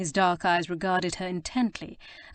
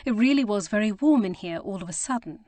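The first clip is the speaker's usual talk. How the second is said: usual speaking voice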